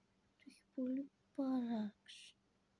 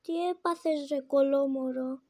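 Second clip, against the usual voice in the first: loud, raised-pitch outburst; exasperated shout; 10 dB louder than usual. Real, not acted. Player scolds the game's baby, exasperated shout.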